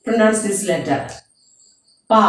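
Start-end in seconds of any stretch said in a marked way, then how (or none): 2.1-2.2 "Pa." with both lips pressed shut, then released; lips closed pa. Shown as a demonstration. Teacher shows children how to say pa, lips closed. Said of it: "We want to close our lip to pronounce this letter".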